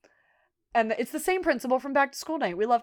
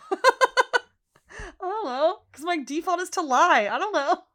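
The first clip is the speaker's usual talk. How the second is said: high, very slow "I don't know" stretched out through a real laugh; can't stop laughing while quoting her; 7 semitones higher, slower than usual. Real, not acted. Podcaster cracking up quoting Fiona's excuse, laughing.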